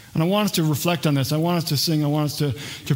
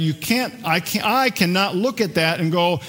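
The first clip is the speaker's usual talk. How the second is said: ordinary delivery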